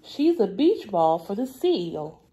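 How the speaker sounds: normal talking voice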